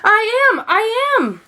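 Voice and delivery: very high, loud cry; desperate shout. A narrator's reading. Trapped son answering his mother, high desperate cry. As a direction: call out that you're here. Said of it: "Sylvester wanted to shout".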